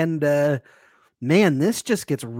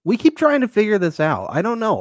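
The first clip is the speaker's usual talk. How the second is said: louder, higher exasperated rant; 10 semitones higher, 5 dB louder than usual. Real, not acted. Fed up with the show's unclear rules, exasperated. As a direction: throw up your hands.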